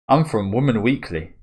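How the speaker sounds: lightly voiced overly polite reporter. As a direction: ask oh so politely. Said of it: "asking so politely".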